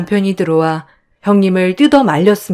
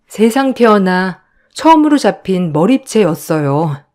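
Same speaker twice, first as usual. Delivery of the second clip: ordinary talk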